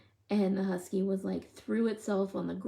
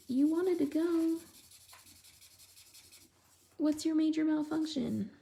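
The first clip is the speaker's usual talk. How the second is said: mock scolding; 9 semitones higher than usual. Scolding her dog in the car.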